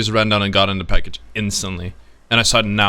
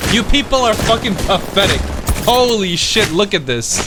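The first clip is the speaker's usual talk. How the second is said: raging outburst; 9 semitones higher than usual. Real, not acted. Streamer raging at a camping full stack in game, high furious yelling. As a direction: yell at the enemy squad in disbelief.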